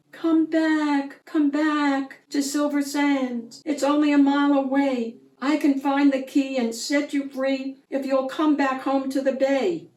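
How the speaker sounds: high seagull voice, urging. A narrator's reading. Seagull urging the mermaid home, high voice. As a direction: call her home like an eager bird. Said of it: "He tapped with his yellow bill".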